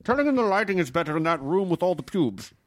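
high, garbled walkie-talkie voice; radio squawk from the director. Acted. Director sending the actor to another room over walkie, in a distorted high voice. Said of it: "I have a, a walkie".

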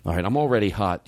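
usual speaking voice

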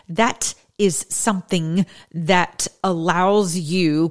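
slow halting delivery. Shown as a demonstration. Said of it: "when they begin to speak like this".

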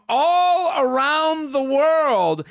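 drawn-out excited. Real, not acted. Gushing thanks to worldwide listeners.

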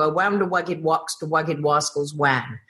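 "wounder wugged wox, the wugged wascals wan" with every R turned to W; childlike W for R in "wugged wascals wan"; says w for r. Retold or imitated. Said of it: "I couldn't pronounce my R's".

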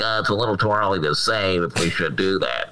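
pinched-nose Ted Cruz impression; pinching nose. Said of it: "Ted Cruz said something like".

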